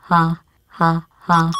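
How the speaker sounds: flat, spaced-out mock laugh; hollow, robotic ha ha ha; fake laugh. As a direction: laugh like you don't mean it. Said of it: "It's actually funny".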